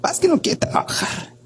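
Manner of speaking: high mock falsetto, trailing into a breathy whisper; mocking the wife. Wife brushing off her husband's anxiety to the doctor, mocking high breathy voice. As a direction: squeak out her dismissal. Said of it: "Y la otra".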